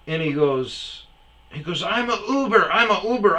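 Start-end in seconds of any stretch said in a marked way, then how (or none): 1.8-3.4 higher, louder mock protest; whiny driver. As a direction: repeat it like a weak excuse. Driver pleading he's Uber.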